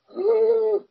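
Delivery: high strangled gasp for air; choking gasp. Retold or imitated. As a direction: gasp like you can't breathe. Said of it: "feeling like I was suffocating".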